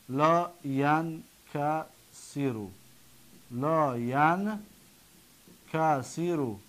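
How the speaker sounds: لا ینکسرو slowly, as la, yan, ka, si-ru; syllable by syllable. Shown as a demonstration. Teacher breaking down the negated present, syllable by syllable. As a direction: sound out لا ینکسرو with long gaps between syllables.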